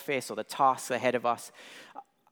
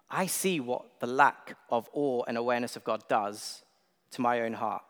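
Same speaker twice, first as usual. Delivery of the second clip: ordinary talk, nothing marked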